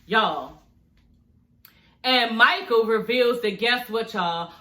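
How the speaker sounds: usual speaking voice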